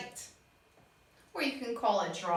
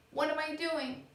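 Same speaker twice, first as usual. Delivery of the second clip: normal talking voice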